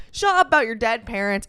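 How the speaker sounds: ordinary talk, nothing marked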